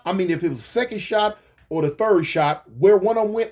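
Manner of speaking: ordinary delivery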